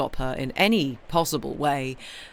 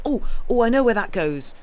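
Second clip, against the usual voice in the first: higher and louder, a sudden spark of discovery; excited realisation; 8 semitones higher, 8 dB louder than usual. Retold or imitated. Jigsaw solver spotting where a piece fits, high and loud with excitement. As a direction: blurt it like you just spotted the piece. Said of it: "And you're".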